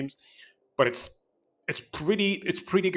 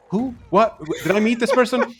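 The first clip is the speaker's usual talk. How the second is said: higher, louder baffled who and what; mock confusion; 9 semitones higher, 5 dB louder than usual. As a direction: play it totally lost at the names. Baffled by unfamiliar character names.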